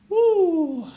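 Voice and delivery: high loud cheer of joy; excited woo. Real, not acted. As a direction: cheer it out, thrilled. Cheering at result.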